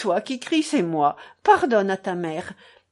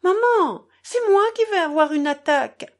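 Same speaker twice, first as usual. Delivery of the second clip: hysterical outburst; 9 semitones higher, 6 dB louder than usual. Acted. Lise's hysterical outburst at mother.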